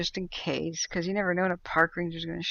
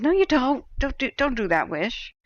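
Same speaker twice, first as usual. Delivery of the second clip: alarmed protest; 7 semitones higher, 4 dB louder than usual. Retold or imitated. Alarmed reply to bear wish.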